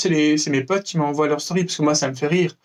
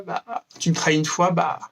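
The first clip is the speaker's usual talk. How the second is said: usual speaking voice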